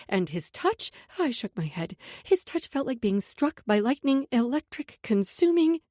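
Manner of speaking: plain reading voice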